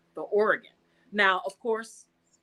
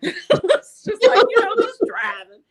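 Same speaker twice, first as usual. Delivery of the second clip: laughing; 14 semitones higher, 11 dB louder than usual. Real, not acted. Laughs recalling the phone call.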